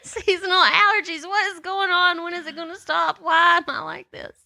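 high, whiny mock lament; mock despair. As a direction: wail in comic despair. Complaining about allergies.

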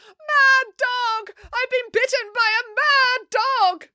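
very high, drawn-out wail; hysterical cry. A narrator's reading. Bitten woman collapsing on the ground, crying out. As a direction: wail it in hammed-up agony and panic. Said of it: "cried the woman".

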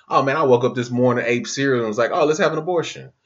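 fast, high, careless parody; mock the breezy chooser; hammed-up airhead voice; mock flippant voice. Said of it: "people are making that choice just".

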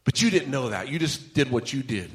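ordinary talk, nothing marked

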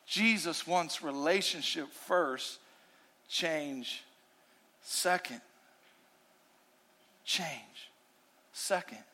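quiet repeated key line; hushed emphatic refrain. Real, not acted. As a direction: let each word sink in quietly. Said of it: "here's my main point".

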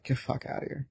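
whispered, stunned disbelief; hushed disbelief. Retold or imitated. Date whispering her shock at his answer, hushed disbelief. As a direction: breathe it out in a whisper, half joking. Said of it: "And she was like".

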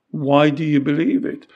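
ordinary delivery

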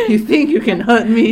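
mock defiance. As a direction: play it tough as a joke. Mock defiance toward friend.

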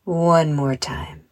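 measured meditation voice with long pauses; unhurried guided relaxation, phrase by phrase. Acted. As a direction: guide children gently through breathing and imagery. Leads a guided visualization.

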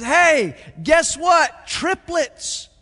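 high, loud shouted announcement; shouting nurse. Acted. Pastor telling a joke, nurse shouting the triplets news. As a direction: yell the big news.

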